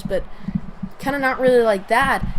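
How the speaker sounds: usual speaking voice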